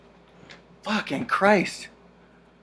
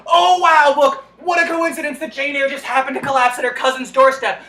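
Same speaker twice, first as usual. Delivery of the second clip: sarcastic amazement; 5 semitones higher, 6 dB louder than usual. Real, not acted. Narrator mocks the coincidence.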